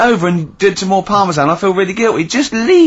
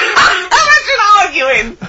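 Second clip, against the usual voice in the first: laughing; 13 semitones higher, much slower than usual. Real, not acted. Laughing at the idea.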